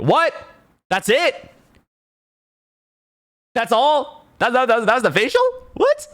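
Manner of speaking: squeaky high incredulous What, That's it; high-pitched outraged disbelief at a rip-off; shocked disbelief. Retold or imitated. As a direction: voice jumps way up in astonishment. Shocked reaction to cheap facial.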